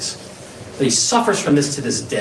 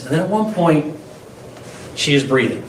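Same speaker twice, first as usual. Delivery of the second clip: ordinary talk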